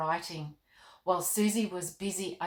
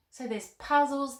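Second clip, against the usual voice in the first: ordinary delivery, nothing marked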